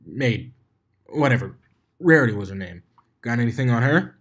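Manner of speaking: cocky, pushy pony asking her own brain for gossip; Rainbow Dash voice. Acted. Dash quizzing her own mind, brash and cocky.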